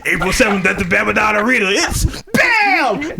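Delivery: high, loud hype announcer shout; monster truck ad. Pretend. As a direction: yell it like a monster truck promo. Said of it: "the old monster truck commercials".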